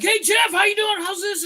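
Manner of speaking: big shouted hello; booming mock hello; loud greeting. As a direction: call out the greeting like spotting an old friend across the room. People greeting him loudly.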